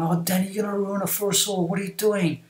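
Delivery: high-pitched imitation of complaining pupils; retold schoolkid scolding, pitched well up; mocking classmates. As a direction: mimic kids ganging up on a classmate. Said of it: "people were mocking him".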